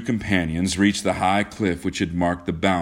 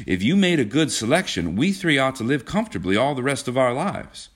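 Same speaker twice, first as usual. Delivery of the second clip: eager, higher-pitched urging; brisk hopeful eagerness, lightly acted; 7 semitones higher than usual. A narrator's reading. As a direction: press on keenly with lifted pitch. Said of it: "said John eagerly".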